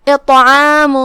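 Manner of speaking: اطعام with a heavy, bouncing ṭa. Shown as a demonstration. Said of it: "پا کا دو حکم ہے". (طا کو بھاری اور ہلا کر)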